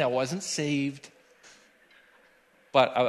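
normal talking voice

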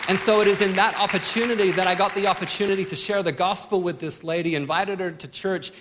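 ordinary talk, nothing marked

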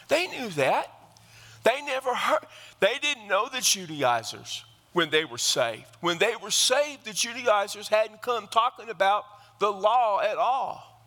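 high, driving preacher's cadence at full force; fiery preaching. Acted. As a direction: preach it hard, rising over the congregation.

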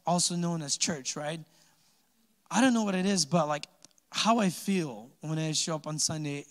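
usual speaking voice

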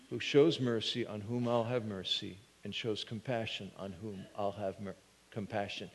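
low, soft, reverent voice; hushed voice of God. Acted. Preacher voicing God's answer to Moses, low and hushed. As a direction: let God's answer fall quiet and low.

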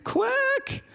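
put-on very high duck quacks from a crying little girl; high pitched tearful duck quack. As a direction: squeak out quacks like a sobbing child playing a duck. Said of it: "she's standing there going".

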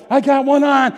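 put-on a little boy shouting his catch; excited kid. Child hooks a fish.

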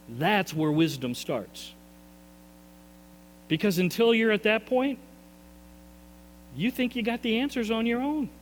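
usual speaking voice

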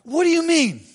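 high, startled exclamation; exclaimed. Retold or imitated. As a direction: throw the question up, astonished. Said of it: "exclaimed Nicodemus".

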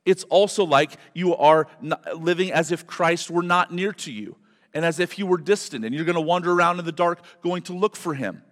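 fervent, loud, high preaching. Acted. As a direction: preach it out to the congregation. Preaching at full force.

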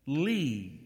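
normal talking voice

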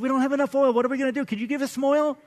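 a touch of worried begging; pleading foolish bridesmaids. Acted. Five foolish bridesmaids begging the wise, lightly pleading.

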